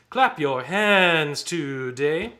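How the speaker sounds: loud rhythmic chant with stretched vowels; spoken in tempo. Shown as a demonstration. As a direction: chant it on the beat to model the entry. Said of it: "let's speak this real fast in tempo".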